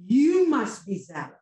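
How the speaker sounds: normal talking voice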